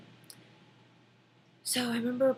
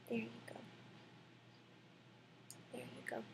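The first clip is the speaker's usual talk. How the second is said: hushed, barely voiced soothing; a whispered there you go; soft whisper; 18 dB quieter, faster than usual. Real, not acted. Whispering to the newborn baby.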